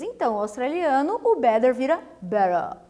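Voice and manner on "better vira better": Australian accent. Shown as a demonstration. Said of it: "ele não fala todas as letras".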